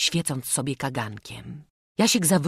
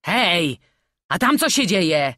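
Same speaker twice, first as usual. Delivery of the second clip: loud, higher call from below; raised-voice demand; Rafał shouting; 4 semitones higher, 7 dB louder than usual. A narrator's reading. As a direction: shout up in alarm. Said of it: "krzyknął Rafał z lochu".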